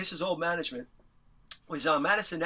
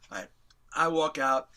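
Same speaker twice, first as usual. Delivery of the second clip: ordinary delivery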